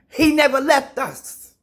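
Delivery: high, loud proclamation; loud preaching. Real, not acted. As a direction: proclaim it with conviction.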